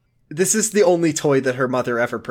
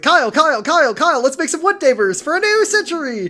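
caffeinated frenzy; 11 semitones higher than usual. Retold or imitated. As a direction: babble like someone wired on caffeine. Hyped-up character on caffeine.

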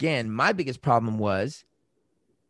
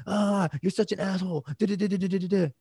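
higher mock rant; mock insulting rant; 7 semitones higher than usual. Partner hurling insults in a fight, mock insulting rant. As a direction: throw the insult, a touch hammed. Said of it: "But if they're like".

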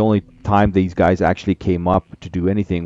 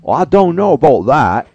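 high, loud put-on southern accent that slips toward English; attempted southern accent; 8 semitones higher, 6 dB louder than usual. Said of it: "It was a southern accent".

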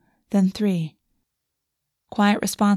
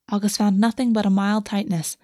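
ordinary delivery

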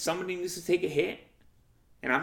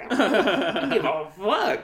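defiant; 5 semitones higher, 7 dB louder, slower than usual. Retold or imitated. Defiant reply to the girl.

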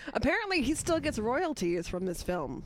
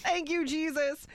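mock praise; 9 semitones higher than usual. Co-host joining the joke, hammed-up praise shout. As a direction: cry out thanks like at a revival.

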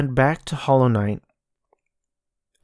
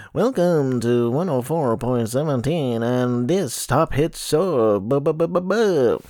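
slow hammed-up radio announcer; 2 semitones higher than usual. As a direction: ham up a radio host intro. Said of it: "radio people... that's not their regular voice".

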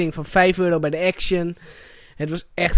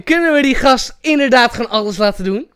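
ordinary talk

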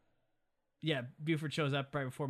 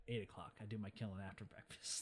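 much lower and softer revised threat; low grudging mutter; 6 semitones lower, 14 dB quieter than usual. Retold or imitated. Retelling the villain revising the hour, low quiet mutter. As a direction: mutter it under the breath, imitating a villain.

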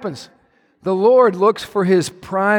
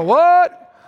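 indignant protest; 7 semitones higher, slower than usual. Retold or imitated. Defensive husband questioning God, higher incredulous voice. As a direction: protest in disbelief with a half laugh. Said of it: "Or you can go".

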